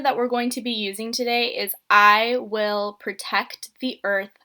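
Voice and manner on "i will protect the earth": very slow sentence starter, each word apart; slow dictation to kids. Shown as a demonstration. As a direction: spell it out slowly for young writers. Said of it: "let me say that a little bit slower".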